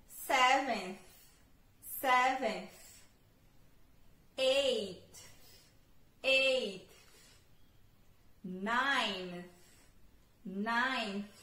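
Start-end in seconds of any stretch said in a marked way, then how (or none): 0.1-2.7 "Seventh" said louder with a final th; ordinal model. Shown as a demonstration. Teacher drills the ordinal for the class. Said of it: "Sétimo". 4.4-6.9 "Eight" said louder and clear; ordinal model. Shown as a demonstration. Teacher drills the number for learners. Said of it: "Sétimo".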